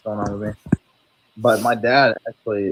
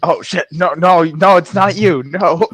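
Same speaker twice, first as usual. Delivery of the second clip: higher, louder startled "no, no"; mock alarm, suddenly loud and high; startled backpedal; 8 semitones higher, 7 dB louder, slower than usual. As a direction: snap awake and apologise in a rush. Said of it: "the next second I'm like".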